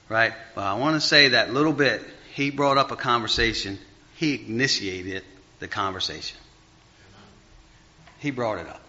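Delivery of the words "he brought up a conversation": ordinary talk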